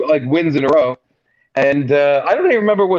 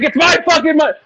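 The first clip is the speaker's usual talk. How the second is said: retold yell; 10 semitones higher than usual. Gambler yelling back at the table, mock shout. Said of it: "And I was like".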